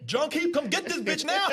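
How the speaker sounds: high-pitched comic holler through laughter; laughing, outraged mock summons; mock calling out. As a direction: call for someone to come, hammed-up. Acts out outraged man calling out.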